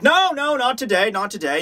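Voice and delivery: high first no, falling away; mock brush-off. Recruiters turning away a volunteer, light mock refusal. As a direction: wave off a volunteer, starting high. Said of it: "And they'll be like".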